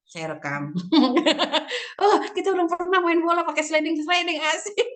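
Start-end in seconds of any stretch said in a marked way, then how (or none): 0.8-2.0 high laugh. Real, not acted. Laughing at pool scene. 2.0-4.9 put-on high, bright child's glee; child cheering about the empty pool; excited kid. Children's delight at drained pool.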